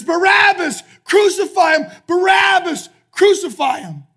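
high, very loud mob cries; crowd shouting. Retold or imitated. Crowd as the jailed prisoner hears it, loud shouting. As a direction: shout each cry like a distant mob at full voice. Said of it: "what he hears is".

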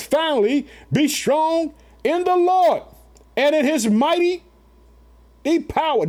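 high, slow, ringing scripture reading; scripture proclaimed. Acted. Preacher reading Ephesians aloud to his family, slow and high. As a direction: lift the verse up slowly to the congregation. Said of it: "Did you hear that?".

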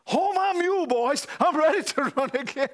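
high, loud joyful cry with a laugh breaking in; joyful outburst. Retold or imitated. Old farmer to his church, a high, loud cry of joy. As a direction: burst out like a farmer ready to run the aisles.

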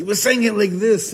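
high, loud burst of mock anger; angry imitation. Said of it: "saying it with such anger".

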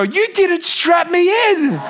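put-on high, slow child's complaint with a laugh; accusing toddler. Son on the car floor tells dad off, high and slow.

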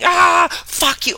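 high, loud street scream; retold scream. Woman outside screaming at passers-by, mock furious shout. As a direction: belt it out like a furious stranger. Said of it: "I see a woman outside screaming".

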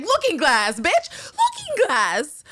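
the name Looking Glass drawn out very high and slow; indignant villain. Retold or imitated. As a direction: insist on your own name, offended. Acting the villain correcting Lori.

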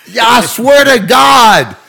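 high, loud, slow mock swearing; hammed-up oath. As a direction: shout the vow like gospel. [name] swears again.

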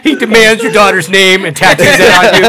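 laughing loud and high while telling it. Real, not acted. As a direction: giggle through the whole story. Laughing through absurd scenario.

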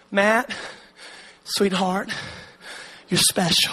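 mother soothing her struggling son softly; tender mom. Retold or imitated. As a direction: comfort him gently like a mom. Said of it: "And she would always go".

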